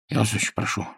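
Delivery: quiet, choked pleading voice; strangled plea, barely holding on. A narrator's reading. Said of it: "каким-то сдавленным голосом".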